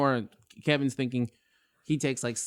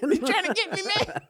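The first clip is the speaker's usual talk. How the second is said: mock panic. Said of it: "I get that call".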